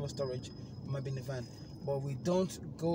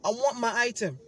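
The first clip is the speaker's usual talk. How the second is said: loud, high mocking rant; loud angry rant; 8 semitones higher, 7 dB louder than usual. Rejecting the agent's apology on the phone, angry rant. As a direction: pile on the scorn.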